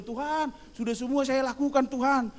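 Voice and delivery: lightly acted self-assured appeal; rich young man. Rich young man answers the Lord, protesting. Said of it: "pemuda yang kaya itu, dia bilang".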